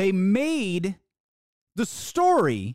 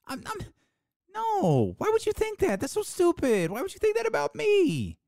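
high, exaggerated offended whine; sarcastic high-pitched mock outrage; mock indignant denial; 11 semitones higher than usual. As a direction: sneer through a fake protest.

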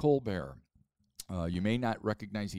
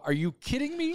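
much higher, incredulous retold cry; retold disbelief; 3 dB louder than usual. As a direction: toss it off as utter disbelief, acting out the moment.

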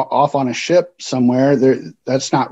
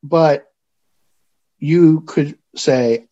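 ordinary talk, nothing marked

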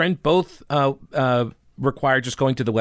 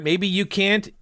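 ordinary talk, nothing marked

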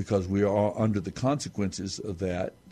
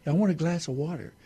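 put-on higher small-boy voice; little boy asking; 7 semitones higher, faster than usual. Grandfather retelling a bedtime story, voicing the boy asking for water. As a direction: ask sweetly like a little boy. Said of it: "And he says".

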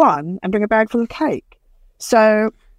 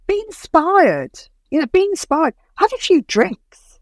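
much higher, slow and emphatic; inspiring urge; 9 semitones higher, slower than usual. Real, not acted. Producer urging new creatives to find inspiration, high and slow. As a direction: lift them up, stretch every word. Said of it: "urging creatives".

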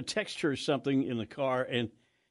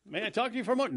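high, polite caller voice; mock telemarketer; 9 semitones higher, faster than usual. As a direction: lilt it like a phone salesman. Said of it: "I've done that on the phone".

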